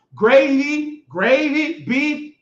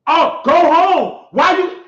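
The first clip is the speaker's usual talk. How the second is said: loud, slow, higher mock yell; 4 semitones higher, 6 dB louder, slower than usual. Man retelling the staffer who yelled go home, loud slow yell. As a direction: bellow it out like the angry staffer. Retold staff yelling at him.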